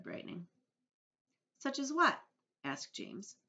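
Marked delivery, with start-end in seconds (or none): 1.6-2.2 put-on high, loudish child's question; curious boy. A narrator's reading. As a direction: pipe up eagerly.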